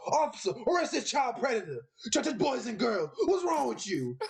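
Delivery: high-pitched hammed-up public accusation; mock accusing vigilante. Imitating a vigilante yelling at a shopper in Walmart, high and hammed-up. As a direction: call out a child predator in the store, mocking.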